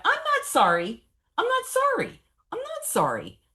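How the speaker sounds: high, loud, furious refusal; defiant shout. Retold or imitated. Defiant outburst before therapists.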